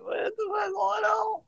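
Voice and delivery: high, squealed mock panic; mock high yell of confusion; mock panicked shout. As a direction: shriek it as if baffled.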